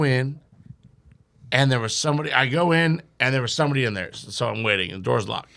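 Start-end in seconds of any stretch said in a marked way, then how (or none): none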